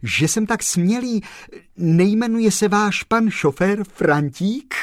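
high, eager blurt. Acted. The postman blurts his guess to the gentleman, high and eager. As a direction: burst out with sudden eagerness.

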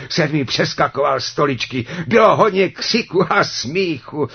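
high, loud, lively storytelling; boisterous narration. A narrator's reading. Narrator describes dwarfs clowning for Sněhurka, boisterous. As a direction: tell it with rising uproar, high and loud.

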